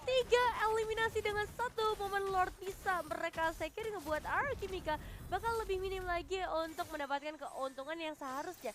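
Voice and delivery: loud, high hyped call; excited casting. Real, not acted. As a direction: shout the eliminations. Co-caster calls eliminations. (komentator esports berteriak heboh)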